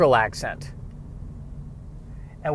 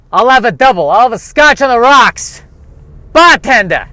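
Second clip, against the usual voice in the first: loud, high Brooklyn bar order; Brooklyn accent; 7 semitones higher, 10 dB louder than usual. Shown as a demonstration. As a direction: bark the order like a Brooklyn regular. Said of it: "accent is in the name".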